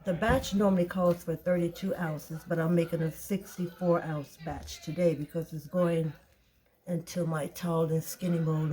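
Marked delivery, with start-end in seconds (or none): none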